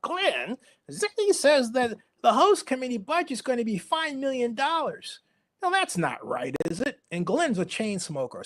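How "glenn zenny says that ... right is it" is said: pitched way up, prim and needling; higher mock of the boss-baiting colleague; mocking Sally's voice. Mimicking Sally to her boss on speakerphone, high and prim. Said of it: "'cause that's how she talked".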